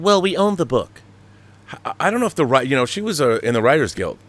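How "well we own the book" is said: snooty corporate brush-off, pitched up; higher mocking studio exec. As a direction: sneer it like a smug executive.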